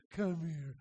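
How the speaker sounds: forgiving owner calling dog over, softly; soft, changed voice. Retold or imitated. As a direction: switch to gentle and call the dog in. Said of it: "and then change your voice".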